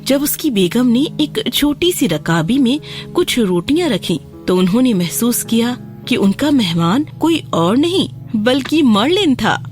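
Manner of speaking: ordinary delivery, nothing marked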